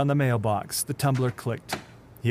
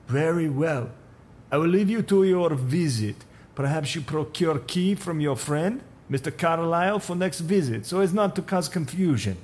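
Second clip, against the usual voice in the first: put-on higher Russian accent, stiffly polite; polite but firm Russian accent; 7 semitones higher than usual. Supervisor backs off from the stranger, stiffly polite, in a Russian accent. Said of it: "Russian accent".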